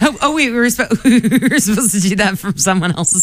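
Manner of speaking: talking through real laughter; laughing realization. Real, not acted. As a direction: laugh while asking, caught out. Laughing over a misunderstood question.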